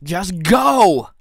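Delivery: the reviewer losing patience with his fanboy side; exasperated order. Acted. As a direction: shove him out the door with your voice. Shooing away inner fanboy.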